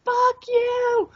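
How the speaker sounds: high mock snap of inner rage. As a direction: hurl it out in imagined fury. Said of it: "Internally, I was".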